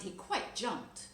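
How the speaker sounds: usual speaking voice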